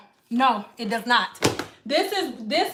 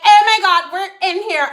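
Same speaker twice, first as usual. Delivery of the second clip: excited; 5 semitones higher, 6 dB louder than usual. Retold or imitated.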